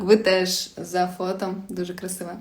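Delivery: normal talking voice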